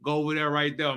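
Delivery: ordinary talk, nothing marked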